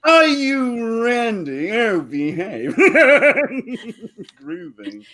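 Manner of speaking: high, slow, hammed-up British Austin Powers drawl. Man hits on a girl in a hammed-up Austin Powers accent. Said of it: "a pickup line that I got from Austin Powers".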